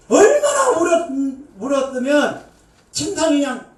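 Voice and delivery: ordinary talk, nothing marked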